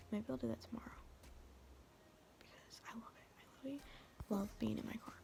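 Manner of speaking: hushed secretive whisper. Real, not acted. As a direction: whisper like someone might hear. Holds whisper throughout.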